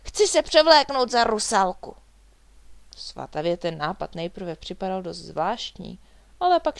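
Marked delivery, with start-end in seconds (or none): 0.0-2.0 loud, eager, high exclamation; excited shout sliding down in pitch; crying out. A narrator's reading. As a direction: blurt the wish out at full voice. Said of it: "vykřikl".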